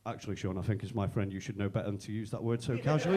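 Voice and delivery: low, measured lecture from a friend; mock solemn reproach.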